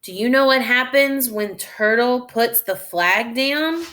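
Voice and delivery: normal talking voice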